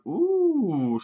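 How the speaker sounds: high drawn-out "ooh"; suspenseful "ooh". Acted. Acts out viewer's suspense reaction.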